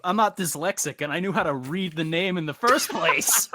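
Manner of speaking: smug retort. Real, not acted. Teasing friend over name.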